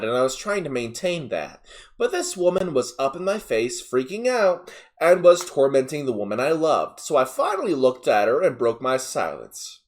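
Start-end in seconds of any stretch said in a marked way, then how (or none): none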